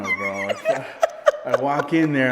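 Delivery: high laughing disbelief. Real, not acted. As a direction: crack up mid-sentence. Laughing at scene.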